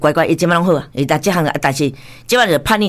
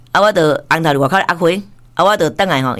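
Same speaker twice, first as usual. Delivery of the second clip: usual speaking voice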